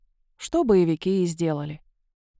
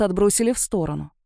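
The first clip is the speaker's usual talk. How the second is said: plain reading voice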